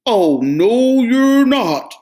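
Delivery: loud, booming troll roar; troll roaring at the goat. Acted. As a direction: roar him down. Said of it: "roared the troll".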